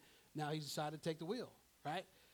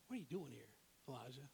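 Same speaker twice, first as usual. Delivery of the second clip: soft, lower, intimate question; gentle small voice; 4 semitones lower, 11 dB quieter, faster than usual. Acted. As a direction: ask it gently, as God's still small voice. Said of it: "Elijah hears a gentle whisper".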